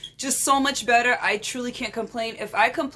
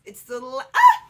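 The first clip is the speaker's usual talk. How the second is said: normal talking voice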